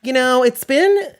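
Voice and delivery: high, loud mock complaining fan; whiny fan complaint. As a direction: whine like an impatient viewer. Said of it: "the same complaint most people had".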